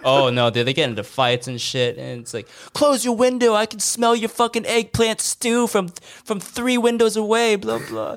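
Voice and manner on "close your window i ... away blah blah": high whiny mocking complaint; mock complaining neighbour. Mocking a neighbour's smell complaint, high whine.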